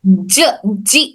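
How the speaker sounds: ordinary talk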